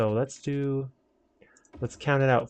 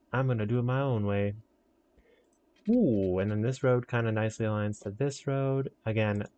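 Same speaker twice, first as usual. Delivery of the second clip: usual speaking voice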